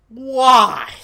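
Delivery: high, loud exasperated why. Real, not acted. Tired streamer loudly asking the chat why. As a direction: throw the question out at everyone. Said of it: "that's my question to everyone here".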